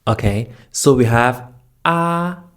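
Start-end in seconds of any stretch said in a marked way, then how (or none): 1.8-2.4 ah held high and level; flat first tone. Shown as a demonstration. Teacher recapping the tones, ah high and flat. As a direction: sustain ah evenly, no glide. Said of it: "The first tone is the flat tone".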